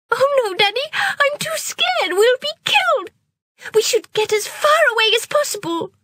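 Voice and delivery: very high, frightened pleading; scared cry. Acted. Girl begging her father not to go, high and terrified. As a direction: plead in terror. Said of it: "cried Amrita".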